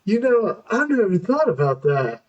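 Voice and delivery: a hint of a dazed stoner; dopey realization. Retold realization quote.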